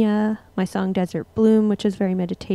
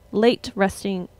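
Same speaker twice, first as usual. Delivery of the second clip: ordinary talk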